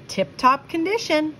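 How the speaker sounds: put-on high, eager boy's reply; cheerfully sure of himself; lightly voiced boy. A narrator's reading. Joe answers mother, voiced.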